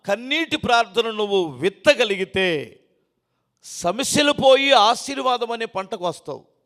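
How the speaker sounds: loud, slow fiery preaching. Acted. Pastor urging the church to pray with tears, loud preaching. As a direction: drive the point home to the congregation.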